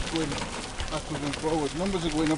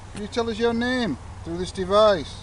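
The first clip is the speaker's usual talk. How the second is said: calling out to spirits; 7 semitones higher than usual. Acted. Ghost hunter calls out to spirits.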